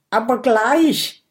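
tense, forced-out words; strained, squeezed out. Acted. Dragon bracing for the needle, strained. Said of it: "presst Big Bo hervor".

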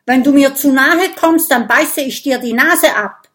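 loud, fast, furious threat; angry shout. Acted. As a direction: yell it at the snake in rage. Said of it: "Oskar ruft zornig".

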